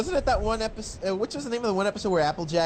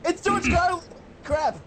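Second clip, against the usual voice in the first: excited outburst; 11 semitones higher than usual. Real, not acted. Excited realization of the conductor.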